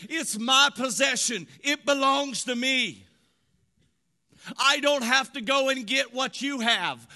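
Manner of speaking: high, impassioned preacher's voice; full-force preaching. Real, not acted. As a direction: preach it high and forceful, driving each point home.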